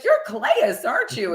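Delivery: high, squeaky imitation of an awed greeting; high-pitched awe. Coach retelling her first meeting with a towering player, high-pitched awe. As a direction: jump up high for the starstruck question.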